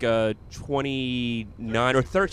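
usual speaking voice

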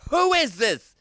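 shrill exasperated aunt; loud high aunt. Retold or imitated. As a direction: bark it up high, annoyed. Aunt on the phone.

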